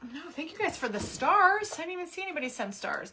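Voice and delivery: a bright burst of thanks to the viewers; delighted thanks. Real, not acted. Surprised thanks to livestream viewers.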